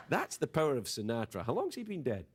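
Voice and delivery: ordinary talk, nothing marked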